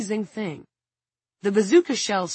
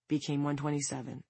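plain reading voice

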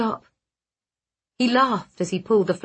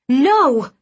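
outraged no; 5 semitones higher, 8 dB louder than usual. Acted. He never told her.

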